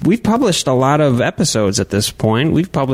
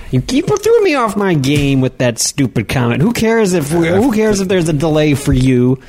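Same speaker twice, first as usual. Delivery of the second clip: raised-pitch, exasperated scolding; annoyed anger; 6 semitones higher than usual. Real, not acted. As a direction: tell off the co-host for throwing you off. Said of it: "hear the anger in your voice".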